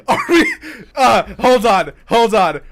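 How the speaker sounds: loud, high, stumbling astonishment; excited disbelief. Real, not acted. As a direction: blurt it out in sudden amazement. Sudden discovery they may be trending.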